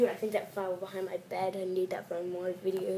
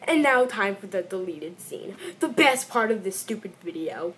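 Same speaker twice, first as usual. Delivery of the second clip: ordinary talk, nothing marked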